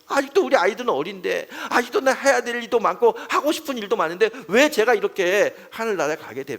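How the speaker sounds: loud, high and anguished, demanding why; angry pleading prayer. Retold or imitated. Dying elder shouting his prayer to God, angry and desperate. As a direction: cry out at God, demanding an answer. Elder voiced angrily praying.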